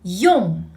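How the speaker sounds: Yong with lips rounded, nasal back ending. Shown as a demonstration. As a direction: shape the lips beautifully on Yong. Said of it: "One more time".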